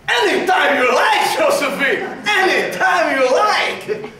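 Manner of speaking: laughing, rising, eager Napoleon; mock eager invitation. Napoleon welcoming Josephine, laughing and eager. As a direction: stretch out anytime you like, delighted. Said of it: "But it would have been more like".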